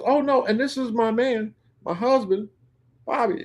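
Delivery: high-pitched retold woman's introduction; wifely introduction. Whitney introducing her husband to the owner, high-pitched introduction.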